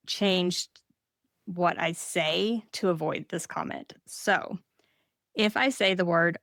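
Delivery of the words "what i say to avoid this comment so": normal talking voice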